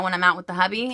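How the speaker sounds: ordinary talk, nothing marked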